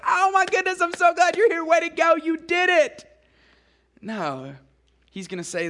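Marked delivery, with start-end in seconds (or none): 0.1-3.0 high, loud, gushing cheer; gushing praise. Pretend. Mock God gushing over a believer arriving in heaven, high and loud. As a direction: ham up a delighted welcome. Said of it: "God's gonna go like".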